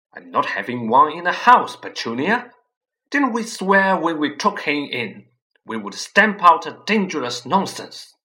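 firm outburst. Acted. Vernon insists to his wife, loud and firm. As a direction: lay down the law loudly.

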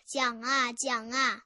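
ordinary delivery

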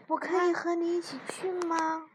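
high, eager little frog asking to come along; excited. A narrator's reading. Little frog begs mouse to join trip, excited. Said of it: "弗洛格很兴奋".

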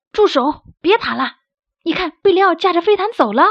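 high, loud breaking-up call. Acted. One bird shouting at the other two to stop fighting, loud.

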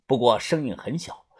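usual speaking voice

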